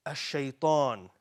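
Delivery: "a shaitan" at half speed, last vowel held long; slow teaching pronunciation. Shown as a demonstration. Teacher explaining the word, "a shaitan" slowly drawn out. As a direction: stretch out the end of "a shaitan".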